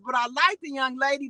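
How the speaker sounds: normal talking voice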